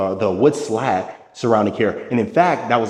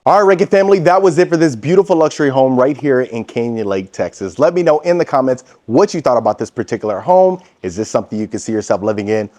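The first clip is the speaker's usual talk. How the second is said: louder, higher, brisk sign-off to viewers; upbeat vlog outro; 3 semitones higher, 7 dB louder, faster than usual. Acted. Host signing off to his viewers, loud and upbeat. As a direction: pump up the audience as you wrap the tour.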